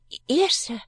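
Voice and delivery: high, stammered answer; timid child. Acted. Frightened child answers stranger.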